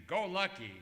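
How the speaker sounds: ordinary talk, nothing marked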